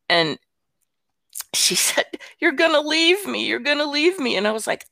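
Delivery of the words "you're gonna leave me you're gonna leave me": high-pitched mock whine; sobbing child, imitated. Granddaughter accusing grandma of leaving, imitated high wail. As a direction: imitate an upset little girl insisting, high. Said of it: "the younger granddaughter starts, like, sobbing".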